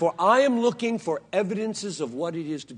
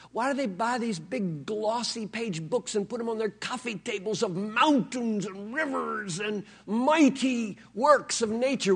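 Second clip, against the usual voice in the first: normal talking voice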